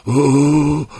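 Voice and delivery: the dying man's wail of agony; anguished cry. A narrator's reading. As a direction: cry out in pain. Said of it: "diye bağırmaya başlamıştı".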